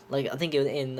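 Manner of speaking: ordinary talk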